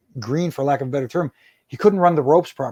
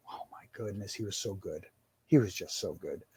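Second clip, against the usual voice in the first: low, very soft, sincere praise; hushed admiration; 7 semitones lower, 14 dB quieter than usual. Real, not acted. Referee fondly remembering a calming colleague, hushed admiration. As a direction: drop right down in quiet awe.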